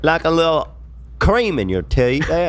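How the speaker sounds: mock British accent. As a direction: offer it in a silly British accent. Continues the mock English bit.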